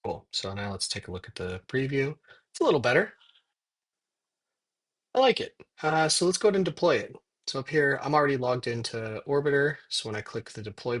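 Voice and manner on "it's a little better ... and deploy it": excited. Real, not acted. Pleased with new font.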